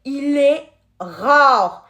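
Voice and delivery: very slow, weighty; slow emphasis. Real, not acted. Reading the card to viewers, slow and impressed. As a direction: draw out the rarity for effect.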